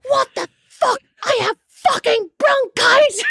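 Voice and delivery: imitating a dad's furious outburst; retold furious shout. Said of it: "I've never heard him sound so angry".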